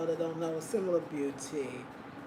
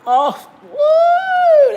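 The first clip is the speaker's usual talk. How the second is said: high, loud delighted "woo"; excited whoop; 17 dB louder than usual. Real, not acted. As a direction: let out a soaring whoop of delight.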